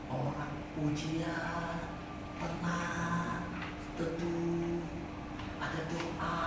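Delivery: a preacher softly describing a serene heaven; calm, hushed. Acted. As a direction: paint a peaceful heaven, slowly.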